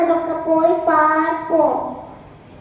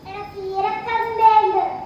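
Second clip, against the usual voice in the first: ordinary talk, nothing marked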